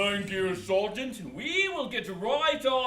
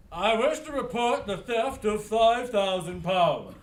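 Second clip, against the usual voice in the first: deep, lower voice; low register; 7 semitones lower than usual. Acted. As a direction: drop the voice down low. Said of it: "in a lower register".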